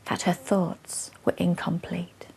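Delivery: hushed, breathy whisper; whispering the realization; dramatic whisper. Acted. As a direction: drop to a whisper for the reveal. Dramatic hush in poem.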